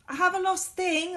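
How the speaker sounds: high, loud call. A narrator's reading. Boy calling out in the dark building. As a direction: call into the empty hall.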